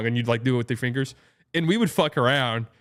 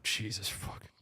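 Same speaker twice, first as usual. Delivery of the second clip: breathless disbelieving whisper; whispered curse; 4 semitones lower, 15 dB quieter than usual. Retold or imitated. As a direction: hiss it under your breath. Parents muttering in the crowd.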